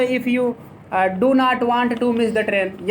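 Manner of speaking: slow dictation. Shown as a demonstration. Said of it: "ये ऐसे भी कर सकते हो".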